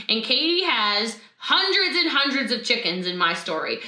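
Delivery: ordinary talk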